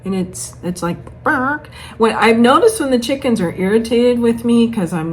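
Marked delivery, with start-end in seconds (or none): 1.2-1.7 mock chicken bark. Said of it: "learning their little chicken talk".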